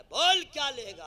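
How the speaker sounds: loud, high demand, hammed up; mock-stern shout; blustering father. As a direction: play a father loudly offering anything. Acts father to crying son.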